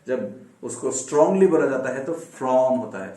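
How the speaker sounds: ordinary delivery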